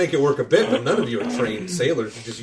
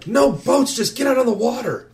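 put-on high, insistent young voice; frantic kid; 9 semitones higher than usual. Kid urging party out to sea, frantic.

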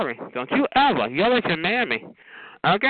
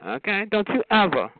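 ordinary talk, nothing marked

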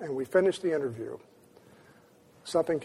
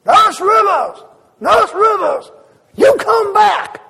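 put-on much higher, loud, slow old man's shout; old man screaming; 13 dB louder, slower than usual. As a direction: shout her name across the yard like an excited old man. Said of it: "screaming at the top of his lungs".

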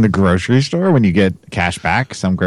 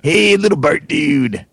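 high, drawn-out cartoon bus-driver call; slow, high stoner greeting; Otto; 10 semitones higher, slower than usual. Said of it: "I'm pretty good at doing the Otto".

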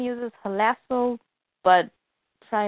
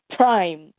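drawn-out mock yell; louder, low storyteller's yell; retold scream; 3 semitones lower, 5 dB louder than usual. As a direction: call the name out loudly, stretched. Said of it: "we see somebody scream".

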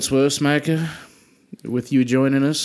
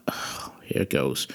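breathy whisper to himself before the dreaded line; whispered aside; 9 semitones lower, 8 dB quieter than usual. Real, not acted. Player whispering to himself before shouting in character, hushed.

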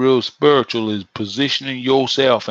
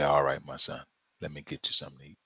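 much lower, soft reply; tender father; 11 semitones lower, 11 dB quieter, faster than usual. Retold or imitated. Father softly reassuring hungry son. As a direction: answer gently like a loving dad.

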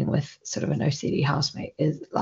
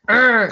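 short, raised mock growl of aggression; a brief, louder "arr", higher than talk; aggressive growl; 5 semitones higher, 7 dB louder than usual. Shown as a demonstration. Explaining what aggressive isn't, mock aggressive growl. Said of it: "it's not like".

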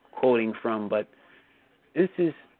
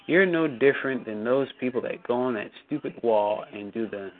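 usual speaking voice

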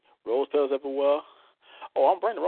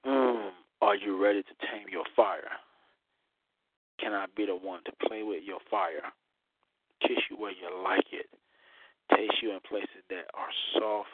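sultry poem reading; 6 semitones lower, 6 dB quieter than usual. Acted. Reads his erotic poem.

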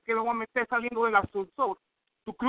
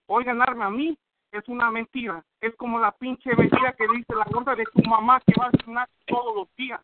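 usual speaking voice

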